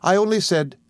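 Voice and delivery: high, timid self-defence; anxious, high-voiced wife trying to explain; timid protest. A narrator's reading. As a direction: start a meek explanation, pitched well up. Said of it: "replied my mother timidly".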